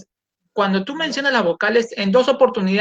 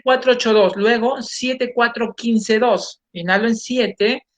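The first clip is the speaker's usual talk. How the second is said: normal talking voice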